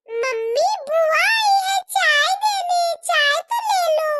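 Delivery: put-on a small girl calling her mummy to take the tea; little girl's voice. Child calls mother for tea. (ऊँची, तेज़ बच्ची की आवाज़)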